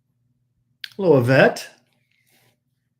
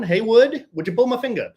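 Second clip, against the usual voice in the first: booming tipsy uncle; 10 semitones higher, 6 dB louder than usual. Acted. Repeated mic check voice.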